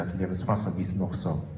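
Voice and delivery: ordinary talk, nothing marked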